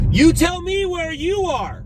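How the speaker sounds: a long, high, drawn-out call; a playful pretend holler at a chat viewer; mock yell. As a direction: stretch it out as if yelling across a street. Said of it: "I don't really wanna yell".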